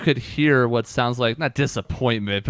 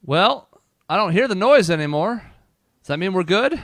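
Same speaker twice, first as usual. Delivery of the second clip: mom's voice, higher and louder, called from below; mom calling up; 8 semitones higher, 4 dB louder than usual. Acted. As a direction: call up from downstairs as the mother. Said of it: "and she says".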